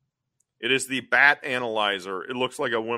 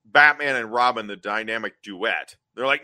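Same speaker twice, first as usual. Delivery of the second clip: ordinary talk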